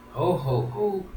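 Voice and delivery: a sobbed ho ho ho rising high at the end; mock sob. Shown as a demonstration. Reader tries Pinocchio's sobbing ho ho ho. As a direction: try out the sobbing ho, ho, ho. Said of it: "I don't think that was the right pronunciation".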